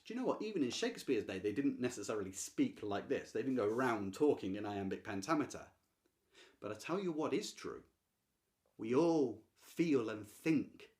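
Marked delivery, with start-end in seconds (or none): none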